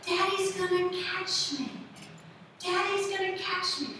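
put-on trusting little child. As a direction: play a child with total faith in Daddy. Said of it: "she's like".

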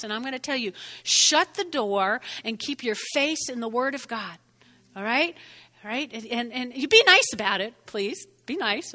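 ordinary talk, nothing marked

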